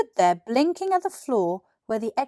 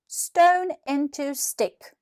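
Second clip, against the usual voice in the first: slow, higher incantation; spell-casting; 6 semitones higher, 3 dB louder, much slower than usual. Acted. Billy casting a spell on the gravel, slow incantation. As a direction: pronounce the spell carefully, like magic.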